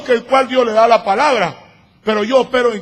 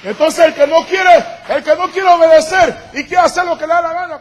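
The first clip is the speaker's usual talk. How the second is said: preaching shout; 5 semitones higher, 6 dB louder than usual. Real, not acted. Preacher rebuking disobedient members.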